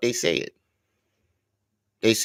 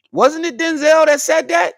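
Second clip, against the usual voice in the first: high, loud insistence; excited; 7 dB louder than usual. Real, not acted. Pressing friends about Denzel, high excited. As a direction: push the question, worked up.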